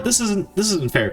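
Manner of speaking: usual speaking voice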